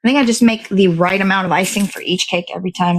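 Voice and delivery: ordinary talk, nothing marked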